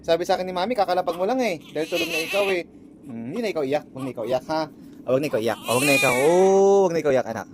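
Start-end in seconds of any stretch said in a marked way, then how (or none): 3.1-7.5 soothing. Real, not acted. Dad telling his child not to cry, low soothing. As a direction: comfort a crying newborn in a lowered voice.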